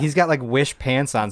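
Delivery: usual speaking voice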